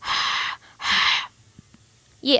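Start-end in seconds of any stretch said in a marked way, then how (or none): 0.0-1.3 हा pushed breathily from the throat; हा, हा voiceless and throaty. Shown as a demonstration. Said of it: "ये हलक से निकालेंगे".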